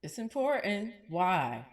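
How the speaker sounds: loud, punchy emphasis; loud emphatic. Real, not acted. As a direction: hit it hard like preaching. Emphatic preaching.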